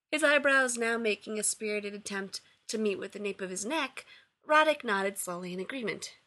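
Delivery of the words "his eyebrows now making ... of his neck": usual speaking voice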